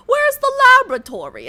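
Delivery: posh British accent imitating her father, fast; a quick British-schooled father's line; Oxford English. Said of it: "The English that he learned in school was Oxford English".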